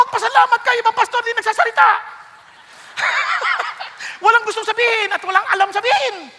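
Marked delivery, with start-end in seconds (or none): 3.0-4.2 a sudden high burst of laughter; short high chuckle. Real, not acted. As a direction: laugh out at your own punchline. Laughing at own joke.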